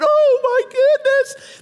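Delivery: high, slow, stunned gasp; awestruck gasp. Retold or imitated. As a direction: gasp in awe at the giant. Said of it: "saw how big he is and went".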